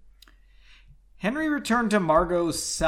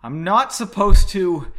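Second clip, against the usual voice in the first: loud blurt; 4 semitones higher, 13 dB louder than usual. A narrator's reading. Nervous character blurts.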